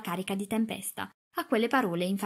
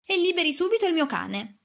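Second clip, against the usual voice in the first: higher-pitched imperious demand; peremptory order; 8 semitones higher, 3 dB louder than usual. A narrator's reading. Girl ordering the woman to free her dog, peremptory. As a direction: insist on being obeyed at once. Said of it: "ingiunse rivolta alla donna".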